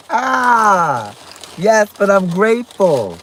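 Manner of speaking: usual speaking voice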